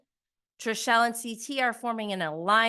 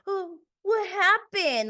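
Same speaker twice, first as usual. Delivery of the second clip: high, slow, fake-innocent question; imitating Dan acting like he knows nothing; mock innocence; 12 semitones higher, 4 dB louder, slower than usual. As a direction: play dumb, wide-eyed. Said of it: "pretending not to know what he did".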